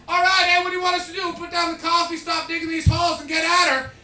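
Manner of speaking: loud, high-pitched hollered reply; mock eager shout. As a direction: holler back like a gung-ho troop. Canadians eagerly answering the order.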